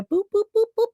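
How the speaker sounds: playful high "boop" sounds; imitated hopping noise. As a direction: pop out rising boops, hopping along. Imitates hopping between channels.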